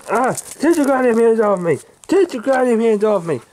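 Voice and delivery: high, heated outburst; angry protest. Acted. Man grabbed by the bouncer, protesting angrily. Acted scuffle, being grabbed.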